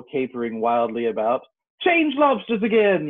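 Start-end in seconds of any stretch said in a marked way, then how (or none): 1.8-3.1 very high, louder yell; storyteller's yell. A narrator's reading. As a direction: yell it out high as the excited Griffin. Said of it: "yelled the Griffin at the top of his voice".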